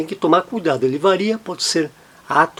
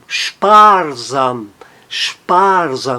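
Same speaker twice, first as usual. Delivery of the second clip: "sparsam" with a long, strong SPAR; stress on SPAR; 3 semitones lower, much slower than usual. Shown as a demonstration. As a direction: lean on spar in "sparsam", drop sam. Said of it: "a sílaba tônica está no spar".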